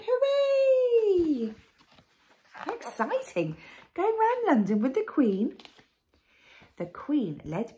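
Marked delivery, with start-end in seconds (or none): 0.0-1.5 very high, loud, drawn-out cheer; children's shout. A narrator's reading. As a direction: cheer like a crowd of kids. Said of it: "And they all shout".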